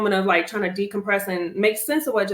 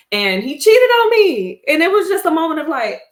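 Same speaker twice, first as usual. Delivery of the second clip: indignant outburst; 9 semitones higher, 8 dB louder than usual. Real, not acted. Indignant aside about cheating ex.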